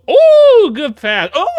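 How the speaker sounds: high, loud ooh, then laughing praise; thrilled whoop. Real, not acted. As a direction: cheer it like the best pass you've seen. Thrilled by a pass.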